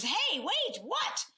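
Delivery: high, rushed and flustered; hurried, harried high voice; frazzled brush-off. Retold or imitated. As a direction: fend him off while juggling the kids. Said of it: "Usually our tone is".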